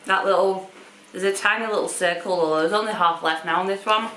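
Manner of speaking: speaking between bites; talking while chewing. Real, not acted. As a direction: mouth partly full of biscuit. Seen 1: Woman holds biscuit, bites it at 0.8, chews while talking.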